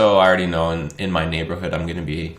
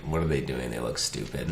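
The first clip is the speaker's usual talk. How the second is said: lower, softer scoffing; sneering peers; 6 semitones lower, 8 dB quieter than usual. Retold or imitated. Young people mocking a filming stranger, lower and softer. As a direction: mutter a sneer at the odd one. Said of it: "the one that's like".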